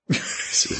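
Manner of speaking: ordinary delivery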